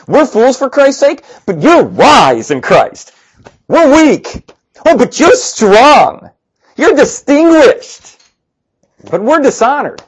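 high, slow mocking contrast; sarcastic. Shown as a demonstration. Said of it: "read it with a sarcastic tone".

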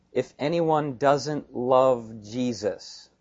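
slow, measured weight on each word of "If anyone doesn't love Jesus"; emphatic. Retold or imitated. Preacher repeats Paul's weighty closing words, slow and emphatic.

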